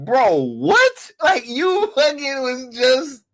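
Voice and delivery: high, stunned bro what; mock disbelief, voice way up; incredulous. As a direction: pitch jumps up in disbelief. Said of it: "And I'm like".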